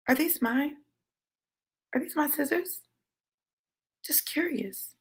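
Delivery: near-whispered mock curiosity with long pauses; calm crazy. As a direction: act out creepy calm in a hush. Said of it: "calm, crazy voice".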